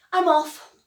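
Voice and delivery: high, loud announcement; bright call-out. A narrator's reading. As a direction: call it out to friends as you leave. Character announces leaving to friends.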